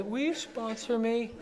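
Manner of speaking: mock-timid, like asking a girl out; shy asking. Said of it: "it's like asking a girl on your first date".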